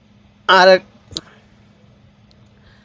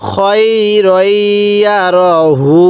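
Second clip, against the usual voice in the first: slow syllable reading. Shown as a demonstration. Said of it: "এরকমভাবে পড়ব".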